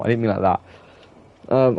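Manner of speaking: ordinary talk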